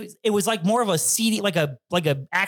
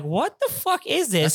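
slow, higher-pitched retold bafflement; stunned disbelief; 7 semitones higher, slower than usual. Storyteller replays staring at the video, stunned disbelief. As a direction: drag it out as if replaying your shock. Retelling shock at what he watched.

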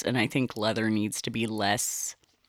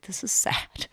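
sad sack; 5 semitones higher, 5 dB quieter than usual. Retold or imitated. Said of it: "I sound like a sad sack".

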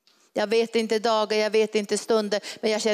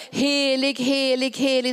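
seraphs calling out; 3 semitones higher than usual. Acted. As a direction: call out each helig like the seraphs. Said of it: "Och de ropade".

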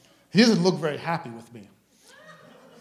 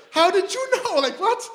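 far higher, louder disbelief; astonished squeak; 4 dB louder than usual. Retold or imitated. Preacher stunned she knows his name, high astonished voice.

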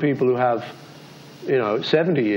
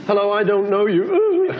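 high, slow greeting that breaks into laughter; laughing through tears; 8 semitones higher than usual. Retold or imitated. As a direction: greet a stranger while half-laughing, half-crying. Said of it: "Right, I'm gonna sit here and cry".

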